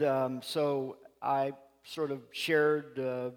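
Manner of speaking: ordinary talk, nothing marked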